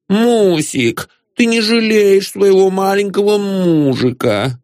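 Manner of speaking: put-on high, cooing babyish whine; pouting husband. A narrator's reading. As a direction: whine sweetly through pursed lips. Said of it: "свернувший уже привычные губы в трубочку".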